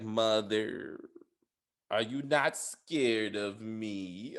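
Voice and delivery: creepy Jason. Retold or imitated. Jason asking his mother if she fears him, low and very slow. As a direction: drag it out like a creepy son. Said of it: "'Cause he's like".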